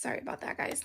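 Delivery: whispering; whispered aside. Real, not acted. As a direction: whisper it under your breath. Aside while fixing camera glare.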